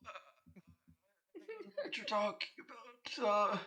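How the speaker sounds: lightly voiced confused Santa; flustered Santa. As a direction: play a baffled old Santa caught off guard.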